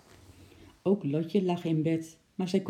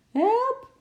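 very high, loud shriek for help; screamed cry; 7 dB louder than usual. A narrator's reading. Mover feels a hairy beast on him, shrieking high. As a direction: shriek it out, pitched way up. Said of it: "hij gilde het uit".

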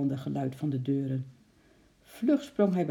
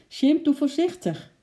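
high, loud warning call; calling upward; 11 semitones higher, 7 dB louder than usual. A narrator's reading. Girl to ape high in tree, calling up. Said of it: "riep Lotje naar boven".

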